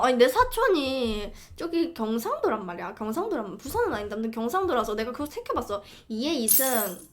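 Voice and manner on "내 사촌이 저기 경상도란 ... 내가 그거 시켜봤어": ordinary talk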